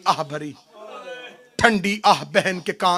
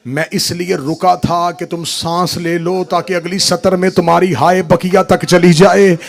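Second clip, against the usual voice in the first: usual speaking voice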